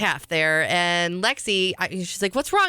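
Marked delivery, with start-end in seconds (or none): 2.4-2.7 put-on child's voice. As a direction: play the worried daughter. Daughter asks from the car.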